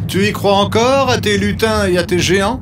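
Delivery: high, taunting jeer; mocking villager. A narrator's reading. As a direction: taunt the fool. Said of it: "disent certains villageois".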